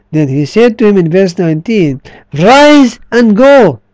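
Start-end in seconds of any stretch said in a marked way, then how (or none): 2.3-3.8 solemn command. Acted. Jesus commanding the healed man.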